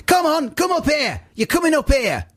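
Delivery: hammed-up bossy matriarch calling over. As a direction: beckon the group forward like a bossy woman. Matriarch calling to queue jumpers.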